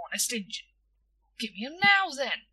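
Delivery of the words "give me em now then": higher, louder, eager grab; eager demand. Acted. Boy demanding sweets from shopkeeper, eager and loud. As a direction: want them right now.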